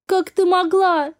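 slow, high, hurt question; anguished disbelief. Acted. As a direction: ask it heartbroken, drawing it out. Daughter shocked by mother's crime.

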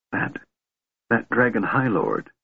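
halting start, stumbling over that; hesitant. A narrator's reading. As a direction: feel your way in, unsure. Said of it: "the Kender said hesitantly".

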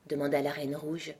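ordinary delivery

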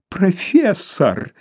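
high, slow, rapturous call; ecstatic cry. A narrator's reading. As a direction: shout it through the door, thrilled. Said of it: "в экстазе воскликнул гость".

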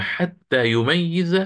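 "يميز" said slowly with a heavy doubled ya, yumayyiz; stressed doubled consonant. Shown as a demonstration. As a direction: lean hard on the shadda in "يميز", yumayyiz. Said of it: "يعني فتصبح".

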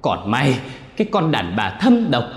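high, loud venomous cursing; possessed son cursing his mother. Acted. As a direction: spit the insult at her. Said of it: "chỉ vào cô Huệ mà chửi".

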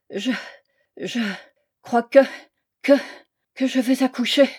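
halting, broken by long pauses; stammering. A narrator's reading. Woman about to give birth telling the priest, stammering. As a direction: stammer it out word by word, struggling to finish. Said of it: "Elle balbutia".

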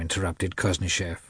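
plain reading voice

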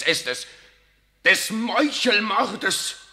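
high, loud and very slow, dragging each syllable; outraged emphasis. Acted. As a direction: spit out the word murder. General accuses SS of murder.

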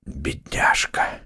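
low, very slow pitying murmur; hushed, husky and grief-struck; hoarse whisper. A narrator's reading. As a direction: whisper it hoarsely, drawing out the word. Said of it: "хриплым голосом шептал Атос".

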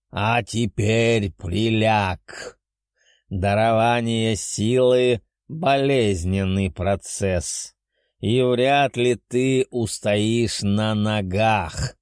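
slow, ominous beast voice; grave dragon. Acted. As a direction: warn like an ancient dragon. Dragon warns of pain.

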